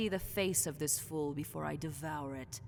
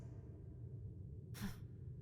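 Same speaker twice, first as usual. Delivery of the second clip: sigh; 12 dB quieter than usual. Acted. Bored sigh at fool.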